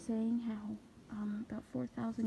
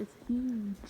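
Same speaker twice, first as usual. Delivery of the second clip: normal talking voice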